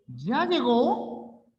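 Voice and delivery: "¿Ya llegó?" slow, high, climbing at the end; ask, weight on the end; rising question. Shown as a demonstration. (pregunta, énfasis al final)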